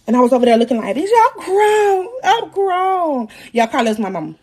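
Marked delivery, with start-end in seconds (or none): 0.9-3.3 high, drawn-out whiny protest; mock defiant wail. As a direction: wail it slowly like a protesting kid. Retelling her defiant wail in labor.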